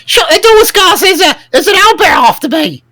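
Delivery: high, loud panicked goblin cry; panicked goblin shout. Retold or imitated. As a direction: yell like a scared goblin. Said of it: "And he'd be like".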